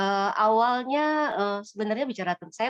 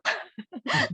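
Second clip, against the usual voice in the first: short high-pitched laugh; brief laugh mid-story; amused laugh; 6 semitones higher than usual. Real, not acted. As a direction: laugh at your own plea. Laughs at her own retold plea.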